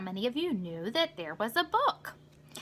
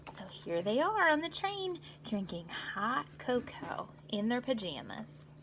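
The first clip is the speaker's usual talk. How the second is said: unvoiced whispered aside; soft secret whisper; 5 dB quieter than usual. Real, not acted. Whispered aside over pictures.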